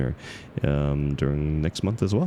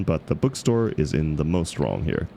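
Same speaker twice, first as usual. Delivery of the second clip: ordinary delivery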